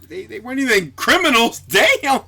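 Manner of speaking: amused, scandalized exclamation; incredulous outcry. Real, not acted. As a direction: squeal in disbelief at the violence. Mock outrage at comic's violence.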